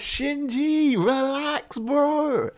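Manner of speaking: high, playful voice telling Shinji to relax; high excited fan voice; high-pitched quote. Retold or imitated. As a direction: squeak it out like a hype fan. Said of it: "I'm like".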